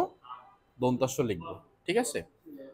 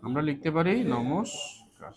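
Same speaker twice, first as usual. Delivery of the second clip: slow dictation; slower than usual. Shown as a demonstration. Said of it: "এরপরে আমরা লিখতে পারি নমস্কার".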